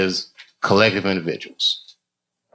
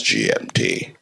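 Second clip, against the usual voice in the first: much lower, mock British time announcer; deep announcer voice; 4 dB quieter, slower than usual. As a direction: intone it like a stiff radio announcer. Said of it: "it goes".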